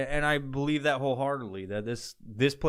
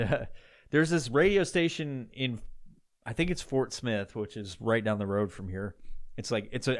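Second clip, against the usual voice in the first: ordinary talk, nothing marked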